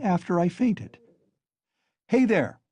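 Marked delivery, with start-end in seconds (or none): 2.1-2.6 high call across the room; shouting to waiter. A narrator's reading. Raskolnikov calls out to the waiter, shouting. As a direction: call the waiter over sharply. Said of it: "he shouted to the waiter".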